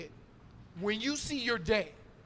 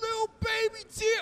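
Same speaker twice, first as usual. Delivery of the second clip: excited shout; 11 semitones higher, 4 dB louder than usual. Real, not acted. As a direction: jump way up and shout it. Excited point about tears.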